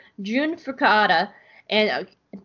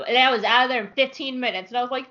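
ordinary talk

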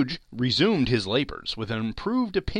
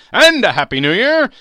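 much louder, higher festive cry; cheery defiance; 11 semitones higher, 16 dB louder than usual. Acted. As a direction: fling the greeting at him. Nephew's parting greeting.

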